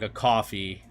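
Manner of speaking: coffee in a New York accent. Shown as a demonstration. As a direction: give "coffee" a New York vowel, cawfee. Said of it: "the New York accent of".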